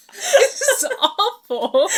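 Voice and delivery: cracking up at how bad it is; laughing. Real, not acted. Laughing while reviewing the awful book scene.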